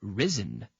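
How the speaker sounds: ordinary talk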